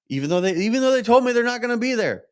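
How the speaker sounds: exasperated outburst. Real, not acted. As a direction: blurt it out, fed up and rushed. Venting about family not home.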